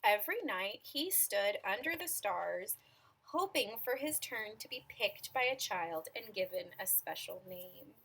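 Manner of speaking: measured storytelling with stretched key words; picture-book narration. A narrator's reading. Reader telling children about an imaginary friend, storytelling voice. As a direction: read it to small children, pausing between phrases.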